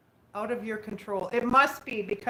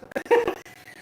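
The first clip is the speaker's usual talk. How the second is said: high laugh; 12 semitones higher, 5 dB louder than usual. Real, not acted. Laughing at a chat comment.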